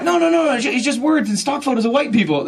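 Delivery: very high, loud protest; acted alarmed backpedaling; flustered denial. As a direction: protest your innocence in a squeal. Denies running an adult blog.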